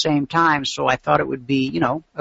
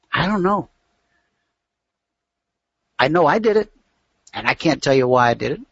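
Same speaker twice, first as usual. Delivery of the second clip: normal talking voice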